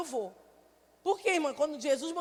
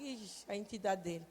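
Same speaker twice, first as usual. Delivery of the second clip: muttered aside; 7 semitones lower, 9 dB quieter than usual. Retold or imitated. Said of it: "eu digo".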